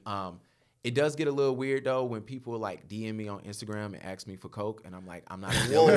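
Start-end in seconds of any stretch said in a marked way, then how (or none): 5.3-6.0 loud, high, laughing denial; exasperated comic yell; mock protest. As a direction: protest it loudly, half laughing. Mock protest at being asked.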